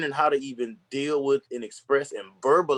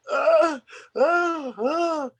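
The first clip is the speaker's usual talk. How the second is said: high-pitched imitation sobs; mock child crying; 3 dB louder, much slower than usual. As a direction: whimper like a hurt little kid walking home. Imitates himself crying as hurt child.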